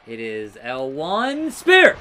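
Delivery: slow, loud announcer call of the move; hammed-up move callout. Real, not acted. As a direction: call the finisher like a ring announcer. Wrestling game commentary callout.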